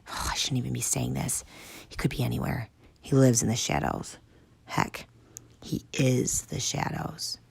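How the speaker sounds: soft fearful hushed words; nervous whisper. Acted. Tank nervously whispering warnings, hushed. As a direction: whisper as if someone listens.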